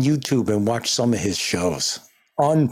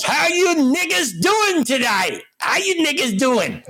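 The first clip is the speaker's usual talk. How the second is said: cheery, raised-pitch greeting of a delivery man; Chappelle's milkman character; 12 semitones higher than usual. Retold or imitated. Retelling a Chappelle skit, milkman greets the family, cheery and high. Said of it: "He goes".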